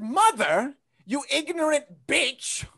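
mock outrage building to a shout; yelling at mother. Comic telling off his mother, ending in a shout. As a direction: tell mother off, rising to a yell.

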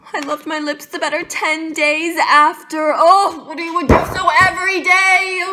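high, loud, gushing delight; elated outburst. Acted. Monologue, elated over the kiss.

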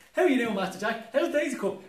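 bright panto hero calling out; Master Jack voice. Acted. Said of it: "I'm gonna do all the roles".

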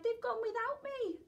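put-on high wailing young dinosaur; crying. A narrator's reading. Little dinosaur left behind by his sisters, wailing high. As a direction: wail it high like a lost child. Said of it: "And he cried".

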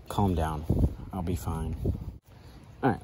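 ordinary talk

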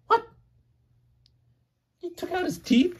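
very high, squeaky mock shock; mock astonishment. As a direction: act amazed for the child. Parent feigns shock to child.

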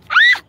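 a sudden high, loud scream; startled shriek. Real, not acted. The frog leaps again and she shrieks high and loud. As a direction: yelp as the frog springs.

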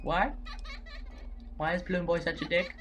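normal talking voice

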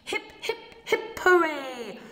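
high hooting hip-hip-hooray; high joyful chant; happy cheer. A narrator's reading. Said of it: "hooted happily".

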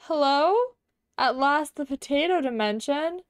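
high, loud, hammed-up funny voice; funny theatrical character. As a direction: ham it up loud and high, like a stage adventurer. Plays a funny theatrical character in game.

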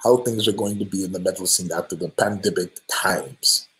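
mock Brazilian accent, lightly done; half-hearted Brazilian accent impression. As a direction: read the fan's question in a playful Brazilian accent. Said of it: "I was trying to do an accent, like a Brazilian accent".

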